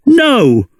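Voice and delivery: put-on high, sharp boy's cry of protest. A narrator's reading. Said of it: "cried Mowgli".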